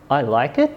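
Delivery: "I like it?" low, then jumping up at the end; questioning intonation. Shown as a demonstration. Teacher showing many ways to say it, questioning "I like it?". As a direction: turn "I like it" into a question, rising on "it". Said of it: "say the following sentence many different ways".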